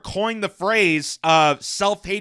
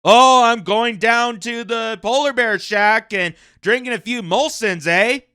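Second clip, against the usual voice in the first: hammed-up Canadian accent, higher and louder; mock Canadian accent; 4 semitones higher, 4 dB louder than usual. Commentator imitating a comic skit's Canadian accent, hammed-up. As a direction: play a cartoon Canadian accent for laughs. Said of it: "comic skit about Canadian stereotypes and start saying".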